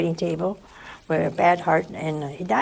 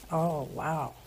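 ordinary delivery

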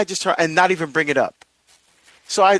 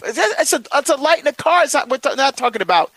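much higher, incredulous at himself; it's only a car light, come on; exasperated disbelief; 10 semitones higher, faster than usual. Real, not acted. Exasperated at his own overreaction.

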